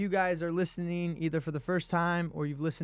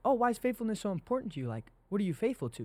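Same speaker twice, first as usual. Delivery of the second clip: higher, quick mock-curious asking; a lightly voiced curious acquaintance, higher and faster; curious questioner; 7 semitones higher, faster than usual. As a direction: play the puzzled friend asking, lightly. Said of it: "they're like".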